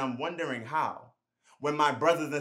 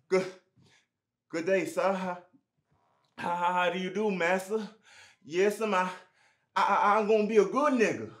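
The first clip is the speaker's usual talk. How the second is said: subservient slave voice. Acted. Voices an enslaved man to master.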